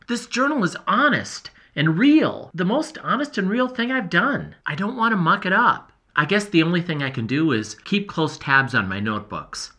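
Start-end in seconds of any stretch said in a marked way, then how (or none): none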